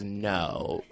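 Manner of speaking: low, soft, drawn-down reply; deadpan clerk. Retold or imitated. Retelling the store clerk's answer, low deadpan voice.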